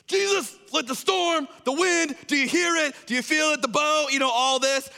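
very high, loud, frantic complaint; hacked off. Acted. Disciples waking Jesus in the storm, hacked off. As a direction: yell in high-pitched exasperation, acting it out. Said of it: "they're, like, so hacked off at him".

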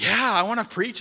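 eager, high and loud, ready to go; excited. Retold or imitated. Telling the pastor he wants to preach, thrilled. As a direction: jump at the chance like a kid. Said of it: "I'm all excited".

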